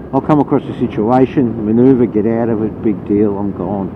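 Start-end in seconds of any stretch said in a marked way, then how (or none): none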